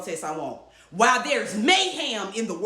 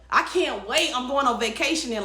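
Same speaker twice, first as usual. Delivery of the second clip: usual speaking voice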